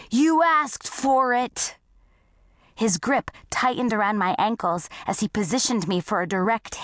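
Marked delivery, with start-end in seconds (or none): 0.1-1.8 high, slow threatening holler, held in volume; drawn-out storybook bully's shout. A narrator's reading. Duke aims Claire at the rowboat, drawn-out mock shout. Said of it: "he shouted".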